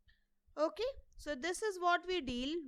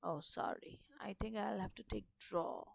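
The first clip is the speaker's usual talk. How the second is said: near-whispered aside, much softer; muttering to oneself; 3 semitones lower, 9 dB quieter than usual. Real, not acted. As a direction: whisper it to yourself while fumbling with a tool. Muttering to self while fixing pen.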